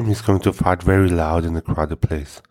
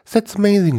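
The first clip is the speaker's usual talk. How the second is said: delighted parent; 14 semitones higher than usual. Acted.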